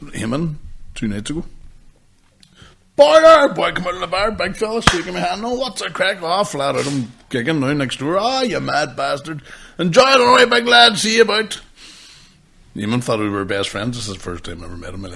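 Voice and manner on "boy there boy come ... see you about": high, hearty mock greeting; overfamiliar stranger. Stranger greeting the podcaster outside a bar, hearty familiarity. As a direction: gush like an old pal, voice lifted.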